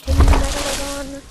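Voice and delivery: loud call to the Megalodon. Real, not acted. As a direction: shout the question at the shark. Calling out to megalodon.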